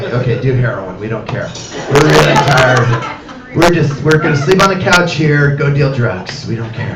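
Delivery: low, droning exhausted parent voice; tired parents. Retold or imitated. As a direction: sound too worn out to care. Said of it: "They're like".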